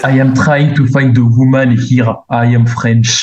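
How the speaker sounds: lightly imitated French accent that slides into Russian; a hint of a mock French accent, close to the speaker's own voice; fake French accent. Mocking the show's fake Frenchman, a light Russian-tinged French accent. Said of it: "That's Russian. That's not French".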